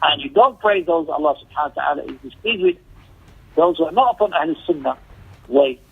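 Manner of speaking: usual speaking voice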